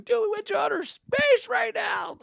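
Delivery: slow, high, loud hammed-up squeal; exaggerating excited kid. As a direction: brag wildly to your cousin. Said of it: "I was exaggerating everything".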